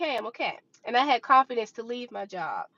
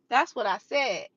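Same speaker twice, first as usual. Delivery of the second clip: ordinary talk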